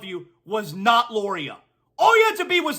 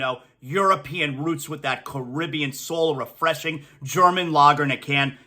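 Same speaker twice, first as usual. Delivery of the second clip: low, smooth sponsor read; 7 semitones lower than usual. Acted. Host reads the beer sponsor spot to listeners, low and smooth. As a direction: sell the beer easy and laid-back.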